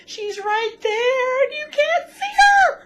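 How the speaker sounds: shouted, high and slow, in despair; yelling she's right there; anguished shout. Retold or imitated. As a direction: scream it at the screen. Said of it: "'cause I was like".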